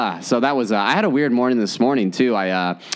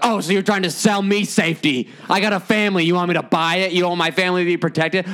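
higher, mock-indignant mom bargaining; guilt-trip the salesman into a freebie; haggling mom; 6 semitones higher than usual. Mom grills a door-to-door alarm salesman, high mock-outrage. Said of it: "And she was like".

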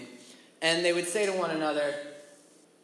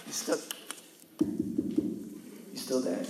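whispered; 8 semitones lower, 9 dB quieter, much slower than usual. Real, not acted. Checking the audience is listening.

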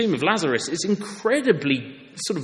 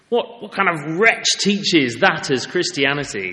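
usual speaking voice

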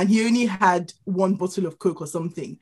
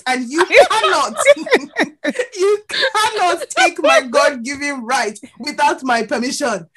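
high, slow, laughing lecture; drawn-out high indignation, half laughing; mock outraged lecture; 7 semitones higher, 3 dB louder than usual. Woman lectures queue jumper on her God-given right, high slow mock outrage.

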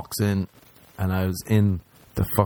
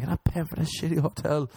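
high thin squeaky voice; 8 semitones higher than usual. Retold or imitated. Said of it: "I'm like".

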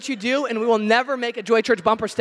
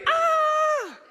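squealed, much higher panic yelp; high mock scream. Pastor acting out panic at an oncoming semi truck. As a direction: shriek like you're being run off the road.

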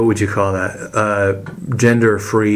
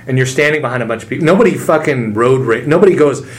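ordinary talk, nothing marked

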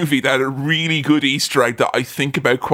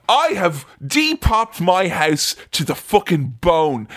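normal talking voice

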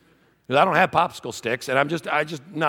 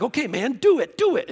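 put-on eager kid; 10 semitones higher than usual. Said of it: "he'd be like".

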